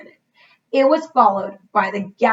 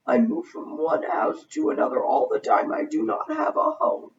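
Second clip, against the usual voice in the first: higher, soft, hushed ghost; a lonely ghost confessing; lightly voiced sad ghost; 6 semitones higher, 3 dB quieter than usual. A narrator's reading.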